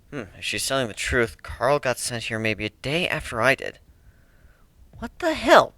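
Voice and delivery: ordinary delivery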